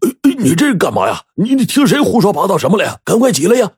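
high, agitated denial; flustered covering up. A narrator's reading. Father hiding his illness from his son, flustered. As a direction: bluster to hide the truth. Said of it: "王书友还想掩饰".

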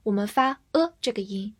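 ordinary delivery

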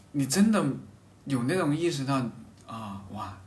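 usual speaking voice

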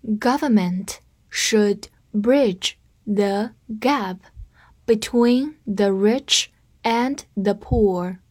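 slow, clear, word by word; slow read. Shown as a demonstration. Teacher slows down so students can repeat. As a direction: spell the sentence out slowly. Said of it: "我们慢读一遍".

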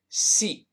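"seat" with a long stretched ee. Shown as a demonstration. Teacher shows the long vowel in "seat", drawn out. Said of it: "拉长一些".

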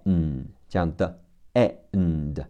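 ordinary talk, nothing marked

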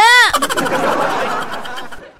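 bright rising laugh at the punchline; high laugh. Real, not acted. Radio host laughing at a listener's joke, high laugh. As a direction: burst out laughing.

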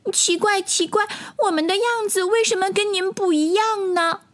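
put-on high-pitched childlike puzzlement; puzzled tadpoles. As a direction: wonder aloud as a tiny tadpole, high. (小蝌蚪用稚嫩高音疑惑地问)

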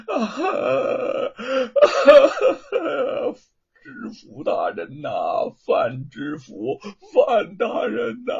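drawn-out crying wail, rising loud at the end; wailing plea. A narrator's reading. Luo Pang bursts in crying injustice to the prefect, wailing. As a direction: wail for justice, stretching each call. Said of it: "哭喊着叫冤".

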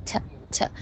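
t as a tiny soft "tuh", twice; soft, short t for a weak "to"; light and short. Shown as a demonstration. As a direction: barely release each t, very softly. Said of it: "to那个音你要读得轻短".

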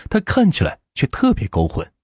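ordinary delivery, nothing marked

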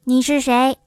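usual speaking voice

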